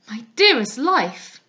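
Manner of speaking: loud, slow, pleading cry of a lover; passionate cry. Acted. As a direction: draw it out, swelling with passion. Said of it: "cried he".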